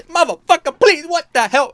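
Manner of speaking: high, loud, mock-outraged outburst; outraged retort. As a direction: fire it back in exaggerated disbelief. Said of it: "[name]'s, like, fucking gone like this".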